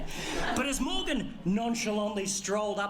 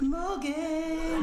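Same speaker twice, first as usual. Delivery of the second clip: high sing-song call for a cat; 7 semitones higher than usual. Retold or imitated. Calling Morgan from the garage like a cat to dinner. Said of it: "Like I was calling a cat for its dinner".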